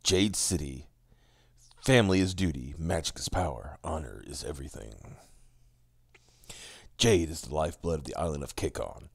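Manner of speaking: low, soft dramatic reading; book blurb narration. A narrator's reading. Co-host reading the fantasy book's blurb, low solemn narration. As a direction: read it out low and hushed, like a trailer.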